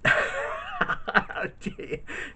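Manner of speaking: high, breathless burst of laughter; real laugh. Real, not acted. Vlogger reacting to a viewer's joke about Boris Johnson, real laugh. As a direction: crack up with a high, airy laugh.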